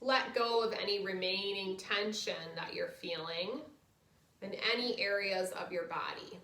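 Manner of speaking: soft, low guided relaxation voice. Acted. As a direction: ease them into rest gently. Leads final relaxation.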